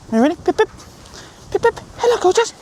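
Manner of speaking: high sing-song pet talk; dog-talk voice. Real, not acted. Owner greeting the puppy Pip, high cooing pet voice.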